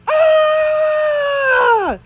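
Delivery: huge, high, loud scream of fright; terrified scream. A narrator's reading. Bully screaming when the fake head blinks. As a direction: scream as if the head came alive. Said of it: "yelled the bully".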